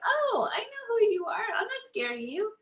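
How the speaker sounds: high, playful talking-down to a feeling; high teasing inner voice; mock confident inner voice. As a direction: sing it out like you caught it. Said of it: "you're like".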